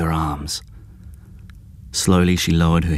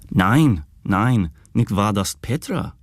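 teasing mock German; 3 semitones higher, slower than usual. A narrator's reading. Jed joking with his brother in mock German. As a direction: ham up a German. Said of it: "Jed chuckled".

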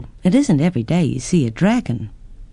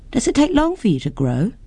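put-on higher, wondering child's question; curious girl; 8 semitones higher than usual. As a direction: ask like an eager little girl. Girl asks the dragon.

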